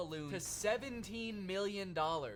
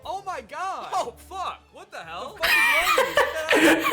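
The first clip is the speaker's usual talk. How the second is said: much higher and much louder, a laughing shriek; shrieking girls; 11 semitones higher, 16 dB louder than usual. Retold or imitated. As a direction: squeal like startled girls at the lunch bar. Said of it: "and girls would go".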